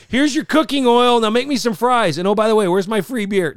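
loud, high demanding customer; pushy customer. Pretend. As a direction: bark orders like an entitled bar patron. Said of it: "No, he didn't say that".

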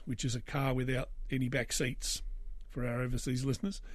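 ordinary delivery

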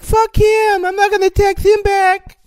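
mock her cold brush-off; high, loud, snippy ex impression; imitating the ex. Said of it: "She's like".

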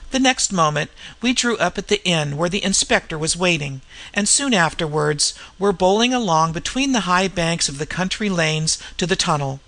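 ordinary delivery, nothing marked

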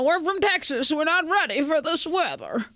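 put-on old Texan man. Joking about Texans and cold weather, light Texan old-man impression. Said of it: "That was like an old man and a Texan".

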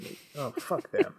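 ordinary talk

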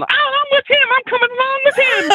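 very high-pitched cartoonish woman's voice; put-on piercingly high character voice; crazy homeless lady. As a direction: squeal it like an eccentric street lady. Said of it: "the crazy homeless lady is".

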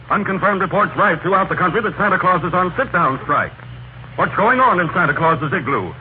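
ordinary talk

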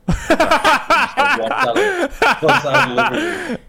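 loud, high-pitched laughing words; laughing hard. Real, not acted. Cracking up after his coach impression, high and loud. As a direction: squeeze the words out through laughter.

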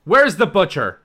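calling out. Acted. Player's character asks the crowd for a butcher, calling out. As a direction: project it over onlookers' heads. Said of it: "I look at the crowd and I say".

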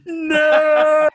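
hammed-up anguished no; mock anguished cry. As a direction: wail it like it's torture. Despair at shop challenge.

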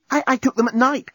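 high, stammering eagerness; excited. A narrator's reading. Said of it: "cried Nettie excitedly".

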